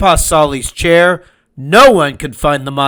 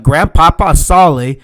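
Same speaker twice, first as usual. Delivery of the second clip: plain reading voice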